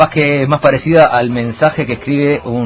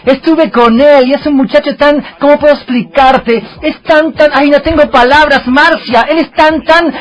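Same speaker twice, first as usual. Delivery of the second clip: much higher, swooning soap-opera gush; dubbed telenovela voice; 12 semitones higher, 5 dB louder, slower than usual. Retold or imitated. Mocking Chilean soap dubbing, telling Marcia about him in a high swooning gush. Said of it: "como hacen los chilenos cuando traducen un teleteatro".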